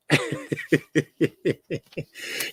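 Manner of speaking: long staccato chuckle; laughing. Real, not acted. Streamer amused that chatter thinks him crazy, laughing. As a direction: chuckle on in a steady run.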